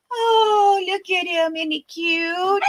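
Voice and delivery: high, slow doting coo. Real, not acted. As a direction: melt over how cute he is. Gushing over the baby.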